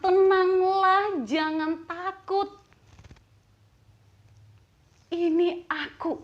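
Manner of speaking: very slow, high, resounding reassurance; Jesus. Acted. Jesus speaking to the fearful, slow resounding reassurance. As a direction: stretch each phrase out, starting loud. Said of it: "perkataan Tuhan Yesus ini yang mengatakan".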